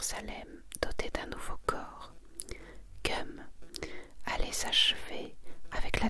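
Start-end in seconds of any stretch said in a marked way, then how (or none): none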